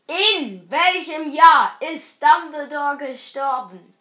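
loud, slow repeat; too loud, headphones on. Real, not acted.

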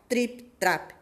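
usual speaking voice

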